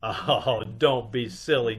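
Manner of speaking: ordinary talk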